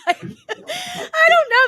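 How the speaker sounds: high, slow, laughing shout of I don't know; babbling through laughter. Retold or imitated. Flustered at the drive-thru speaker, laughing and babbling. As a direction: blurt it out helplessly through a laugh. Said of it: "I just started babbling".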